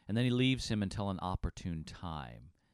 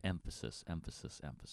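each repeat softer until a breath; fading echo; 4 semitones lower, 9 dB quieter than usual. Shown as a demonstration. Co-host echoing the word to stress repetition, fading away. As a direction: let it die away like an echo. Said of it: "By sake of repetition".

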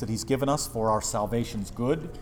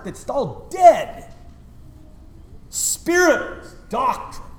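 slow, higher mock disdain; mocking dismissal; 10 semitones higher, 5 dB louder, much slower than usual. Pastor mimics critics deriding doctrine, slow scornful mock. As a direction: drag it out with scorn. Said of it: "people talk like this".

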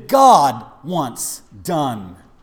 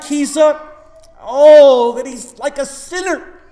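mock outrage; 7 semitones higher, 7 dB louder than usual. Congregation voiced confronting John.

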